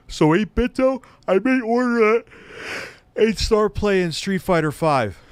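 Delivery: talking through a real yawn. Real, not acted. Streamer reading out to chat mid-yawn, yawning. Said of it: "Sorry I'm yawning".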